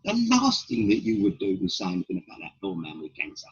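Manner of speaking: normal talking voice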